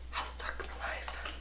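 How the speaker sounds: hushed whisper aside. Acted. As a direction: breathe it secretly.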